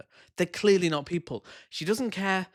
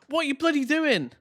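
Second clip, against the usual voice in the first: mock outrage; 12 semitones higher, 4 dB louder than usual. Mocking reaction to her actions.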